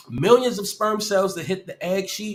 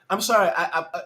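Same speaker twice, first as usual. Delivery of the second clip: ordinary talk, nothing marked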